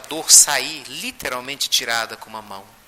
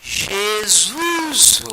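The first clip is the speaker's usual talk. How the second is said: high, long-drawn proclamation of the name; exalted utterance; 6 semitones higher than usual. Retold or imitated. Valéria giving Chico her one word, exalted utterance. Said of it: "Só vou falar uma palavra".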